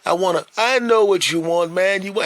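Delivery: high, loud, friendly push; eager shop owner. Retold or imitated. Owner urging old customer to get sandwich, high and eager. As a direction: press him warmly to eat.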